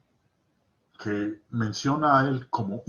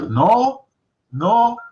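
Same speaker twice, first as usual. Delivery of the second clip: emphatic no; 7 semitones higher, 8 dB louder than usual. Real, not acted. Preacher correcting the verse.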